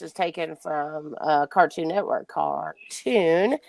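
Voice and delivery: slow and low, drawn out, each word stretched. Real, not acted. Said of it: "I don't know why I just said it like that".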